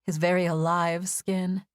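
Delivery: ordinary delivery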